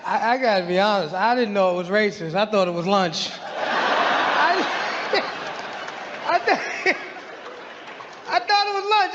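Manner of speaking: words broken by real laughter; laughing through it. Real, not acted. G telling the audience his side, laughing helplessly. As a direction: crack up mid-sentence.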